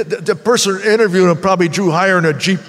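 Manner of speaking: ordinary talk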